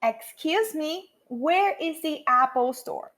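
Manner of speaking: very slow, drawn-out English phrase; measured language-teacher repetition; model reading. Shown as a demonstration. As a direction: say it slowly for students to repeat. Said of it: "vou te dar um tempo pra repetir".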